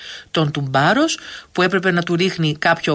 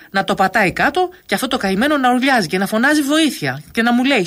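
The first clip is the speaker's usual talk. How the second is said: higher, dramatised mock outrage; animated, pitched-up account of torment; vivid storytelling; 6 semitones higher than usual. A narrator's reading. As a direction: build up the tall tale theatrically. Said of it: "ο Μούτσης συνέχισε παραστατικά".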